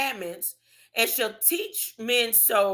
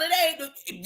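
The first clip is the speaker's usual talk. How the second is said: normal talking voice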